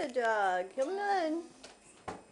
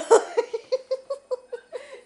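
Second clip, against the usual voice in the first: delighted laugh; 11 semitones higher, 6 dB louder than usual. Real, not acted. Dog dances in new boots.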